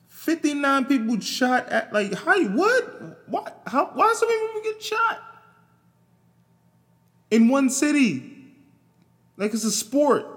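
high, loud exasperated outcry over the shootings; outraged shriek. Real, not acted. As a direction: shriek it in disbelief. Outraged rant about Chicago shootings.

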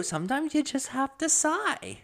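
high mock sighing voice; imitating wife. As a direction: quote her in a high sigh. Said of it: "To literally quote you".

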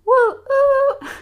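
a high, loud hooting whistle call; high, loud woo-oo of a locomotive; train whistle. Acted. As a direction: hoot like a steam train whistle.

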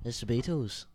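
lightly put-on Liverpool accent; a hint of mock Scouse. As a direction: toss it off as a Liverpudlian bandmate. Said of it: "That's a good Beatles impression".

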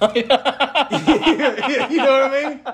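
laughs through the words, stretched out slow; cracking up while asking; can barely get it out for laughing. Real, not acted. Laughing at his own joke.

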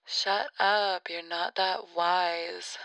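slow put-down through a telephone filter. Pretend. Weird voice heckling the podcaster's wisdom, telephone sound. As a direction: heckle as a voice on the phone. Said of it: "telephone vocal voice".

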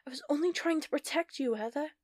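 high, pained sorrowful plea. Acted. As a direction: let sorrow break through. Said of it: "a hint of sorrow in her voice".

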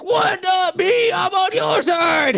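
high, drawn-out mock shout of protest; hammed-up indignant yell, slow and high; yelling John McClane. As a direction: holler up at a helicopter, desperate. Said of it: "And then John McClane is like".

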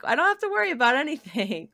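high, airy mock-carefree quote. Wife mocking her own carefree attitude about safety, airy and high. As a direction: parody her own careless self, high and light.

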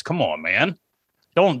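ordinary talk, nothing marked

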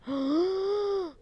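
long, high intake of breath; real shocked gasp. Real, not acted. Host spots a doll collection online, astonished gasp. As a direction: gasp in delighted disbelief. Sees dolls' birthday collection news.

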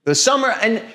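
jumps way up indignantly; shrill protest at the claim; high indignant. Real, not acted. Mock outrage at mosquito claim.

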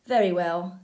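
loud, gruffly conceding troll. A narrator's reading. Troll letting the goat pass, loud. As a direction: give in loudly as the troll. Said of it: "said the troll".

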